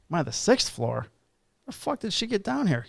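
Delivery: stunned, mocking repeat; incredulous. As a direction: echo "sixth floor" in disbelief, then a high "The fuck did she get down here". Cop repeats in disbelief.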